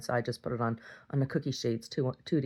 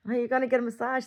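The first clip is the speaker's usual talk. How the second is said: a bit higher, sing-song question; asking the couple in a bright, higher voice; higher friendly question; 10 semitones higher than usual. Retold or imitated.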